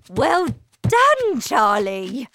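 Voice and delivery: loud, high-starting cheer; joyful shout. A narrator's reading. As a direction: shout out hearty congratulations. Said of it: "shouted Grandpa Joe".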